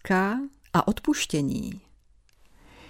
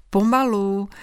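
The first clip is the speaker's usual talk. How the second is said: smiling janitor; 3 semitones higher than usual. A narrator's reading. School janitor to a rushing girl, a smiling warning. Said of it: "řekl jí s úsměvem".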